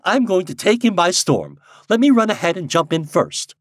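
lively, light-hearted bravado; merry. A narrator's reading. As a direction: boast gaily about winning someone over. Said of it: "cried Helen Wingate merrily".

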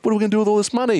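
put-on high, thrilled kid wondering; excited kids. Kids wondering what to do with found money, high thrilled. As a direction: soar up with childish delight.

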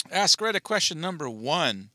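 a mock game-show announcement; light announcer voice. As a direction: present it like a host. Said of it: "announcing the question".

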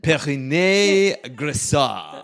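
slow French accent on "Perinet Grissart"; "Perinet Grissart" drawn out the French way. Shown as a demonstration. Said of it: "Say it in a French accent".

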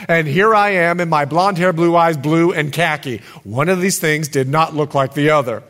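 normal talking voice

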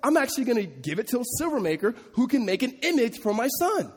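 pious show-off mom; mock pious mother. Pastor mocking Micah's mother giving silver for an idol, showy and pious. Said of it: "But then she goes and goes".

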